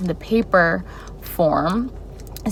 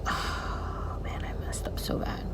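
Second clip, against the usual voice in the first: whisper; 14 dB quieter, slower than usual. Retold or imitated. Thinks she lost the job.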